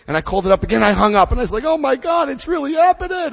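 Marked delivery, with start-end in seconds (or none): 1.6-3.3 much higher, panicked gasp of realization; retold panic. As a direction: squeak it out in sudden panic. Panicking after call to hotline.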